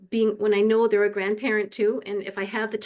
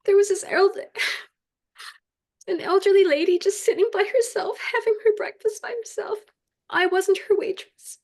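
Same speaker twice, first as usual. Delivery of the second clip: high, tear-choked voice with halting breaks; real weeping, pushing the words out between pauses; crying; 9 semitones higher than usual. Real, not acted. Waitress telling the group about a lonely elderly lady, crying.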